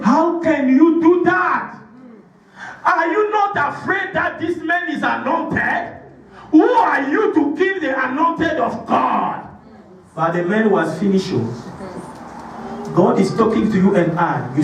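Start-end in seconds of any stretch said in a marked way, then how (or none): 0.0-9.5 angry rebuke. Retold or imitated. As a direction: thunder at him like an outraged king. David rebukes the boy angrily.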